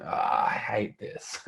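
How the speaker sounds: quiet, drawn-out grumble to himself; retold inward groan. Founder recalling his reaction to advisers, mock weary groan. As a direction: sigh it out slowly, half under the breath.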